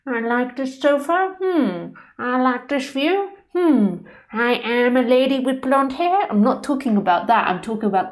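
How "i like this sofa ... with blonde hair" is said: higher-pitched caricature of posh English; posh English accent. Acted. Speaker mocks personal awareness of sofa and view, posh English accent. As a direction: put on posh English self-regard, musing hums between phrases. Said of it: "a very posh English accent".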